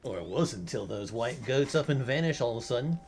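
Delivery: put-on a light, lively Australian accent reading the dialogue. Gamer reads bird lover's dialogue in a light Australian accent. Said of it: "like, an Australian accent for some reason".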